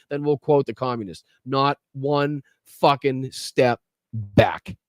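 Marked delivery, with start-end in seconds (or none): 1.5-4.8 very slow, word by word; emphatic slogan. Real, not acted. Host rallying viewers with a slogan, slow and emphatic. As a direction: space out every word for weight.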